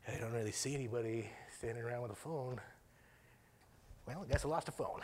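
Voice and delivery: soft, half-hearted talk to oneself; awkward muttering. Acted. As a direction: give up quietly, without calling out. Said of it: "I'm too awkward for that to happen".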